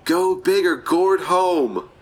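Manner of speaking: high, slow, hammed-up catchphrase; action-hero one-liner. Player delivering a finishing one-liner, hammed-up and drawn out. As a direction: stretch out each word like a big punchline. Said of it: "I need another one-liner".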